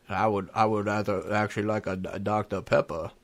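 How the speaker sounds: low, soft, halting simpleton voice; Forrest Gump. Acted. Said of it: "He says".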